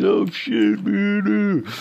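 high, loud mock wail; imitated dismay. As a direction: squeal it out as hammed-up horror. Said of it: "I know y'all are like".

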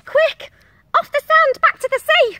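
very high, loud little snail voice; urgent snail cry. A narrator's reading. Snail urges the beached whale seaward, high loud cry. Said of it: "cried the snail".

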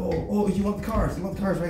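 high, eager rich-man voice; gushing billionaire. Acted. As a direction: fawn over the hero like a giddy tycoon. Billionaire offers cars.

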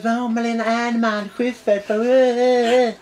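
high, slow mocking singsong; silly fooling about. Mocking the left fawning over celebrities, silly whine. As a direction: drag it out in a goofy high voice. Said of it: "suttit och fjantat er".

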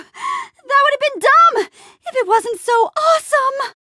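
very high, thrilled exclamation; exhilarated. Acted. Reacting to a dumb but awesome stunt, exhilarated. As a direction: squeal it with a rush of adrenaline.

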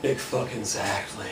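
low, soft, drawn-out world-weary voice; bored ennui. Acted. Friend across the coffee shop table, bored ennui. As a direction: stretch it out low and quiet, utterly bored. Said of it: "exhaled a puff of stylish smoke and ennui".

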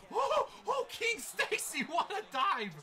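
very high, loud, laughing amazement; excited shouting. Real, not acted. Gamer hailing King Stacy's dive, thrilled shout. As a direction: whoop at a great play. Reacts to a diving play.

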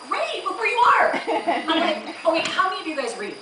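high, loud, delighted sales voice; gushing enthusiasm. Acted. Bookseller thrilled that a woman needs a book, gushing. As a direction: burst out loudly, pitched right up.

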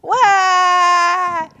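high, loud drawn-out wails; screaming tantrum. A narrator's reading. Turtle throws a tantrum for the animals, screaming. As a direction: scream each cry long and high. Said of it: "screamed Turtle".

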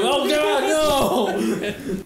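loud, high laughing "Oh, God, no"; panic scream. Acted. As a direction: shriek on cue, half laughing. Cue reaction, acting game.

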